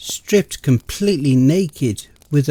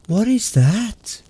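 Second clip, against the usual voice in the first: uneasy question; 8 semitones higher, slower than usual. Acted. Confused at strange symbol.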